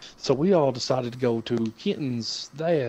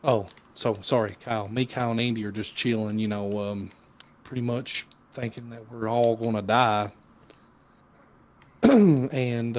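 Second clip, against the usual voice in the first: usual speaking voice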